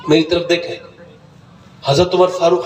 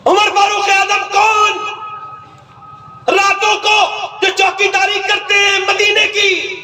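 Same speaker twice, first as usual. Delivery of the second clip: preaching at full force; 10 semitones higher than usual. Acted. Preacher praising Umar's night watch, full-force preaching. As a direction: call it out over the crowd.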